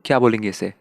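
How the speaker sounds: usual speaking voice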